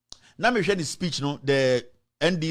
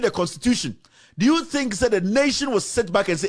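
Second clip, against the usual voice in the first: heated rant; 6 semitones higher than usual. Real, not acted. Ranting at ecowas leader's statement.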